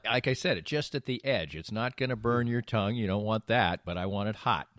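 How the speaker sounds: ordinary talk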